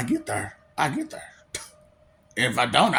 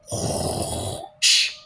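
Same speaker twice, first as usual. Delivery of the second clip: soft breathy whoosh of a balloon burner; whoosh sound effect; 3 dB quieter than usual. Pretend. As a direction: blow it out like escaping air. Imitating a hot air balloon.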